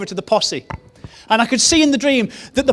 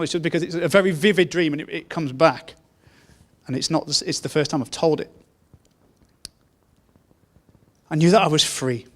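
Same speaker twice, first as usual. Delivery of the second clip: emotional, hushed; 5 semitones lower, 4 dB quieter than usual. Real, not acted. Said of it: "If I got a bit emotional".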